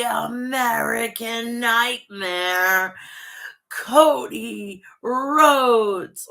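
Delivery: drawn-out ring announcer call, very slow on the name; ring announcer intro. Pretend. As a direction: stretch the name out like an arena introduction.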